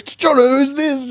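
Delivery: high, frantic phone voice; panicked caller. Retold or imitated. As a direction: blurt it out up high, acting panicked. Retold panicked caller asks who answered.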